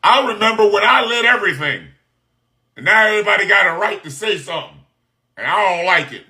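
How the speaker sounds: a lightly voiced whiny old boss, the middle line rushed; mock aggrieved man. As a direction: mimic a sulking man who misses being in charge. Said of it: "That masculine shit can be best summed up in".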